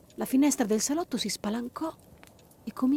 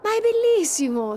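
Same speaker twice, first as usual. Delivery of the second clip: much higher, louder joy; girl enchanted by the snow; delighted smiling; 12 semitones higher, 5 dB louder than usual. Acted. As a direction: smile through the words. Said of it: "sorrise Giulia".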